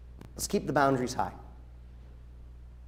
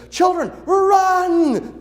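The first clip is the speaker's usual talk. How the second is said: high, slow, loud panicked cry; hammed-up alarm at the children; mock alarmed shout; 8 dB louder, much slower than usual. Overcautious teacher yelling at kids to run, mock panic.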